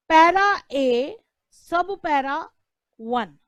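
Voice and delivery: name every level with a pause, slow and emphatic; correct reading. Shown as a demonstration. Teacher demonstrating to students the right way to read the citation. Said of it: "आप इसको पढ़ेंगे".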